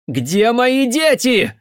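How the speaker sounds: high, loud, drawn-out hysterical demand; hysterical cry. A narrator's reading. Mother with a gun demands her children, hysterical. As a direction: cry out as if losing control. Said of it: "Её голос истерически сорвался".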